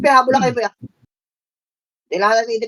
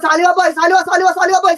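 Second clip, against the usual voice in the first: high, loud repeated direction; urgent shouted callout; 6 semitones higher, 6 dB louder, faster than usual. Real, not acted. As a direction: bark the lane call at the team.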